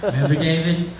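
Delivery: ordinary talk, nothing marked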